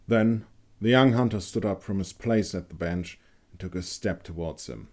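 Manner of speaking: usual speaking voice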